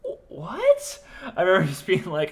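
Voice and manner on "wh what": high, rising confused what; baffled what. Retold or imitated. Recalling blackout to friend, high baffled what. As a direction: squeak it out in total confusion.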